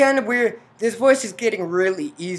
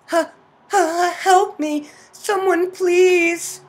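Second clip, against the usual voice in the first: high, loud plea with panicked gasps; panicking; 12 semitones higher, 7 dB louder, slower than usual. Acted. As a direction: act a heroine desperately calling for help. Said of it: "I'm panicking".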